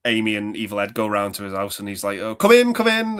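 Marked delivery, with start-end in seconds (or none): 2.2-3.2 put-on high, theatrical old actor voice; Peter Vincent impression. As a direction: camp horror host voice. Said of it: "doing impressions of Peter Vincent".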